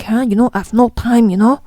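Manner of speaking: excuse-making voice. Retold or imitated. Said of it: "you are like".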